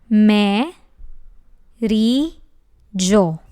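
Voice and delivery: "Me, ri, gio" each said apart, loud and slow; syllable by syllable. Shown as a demonstration. Teacher finishes syllables of pomeriggio, slow and separated. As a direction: spell out the syllables with pauses. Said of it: "This word has five syllables".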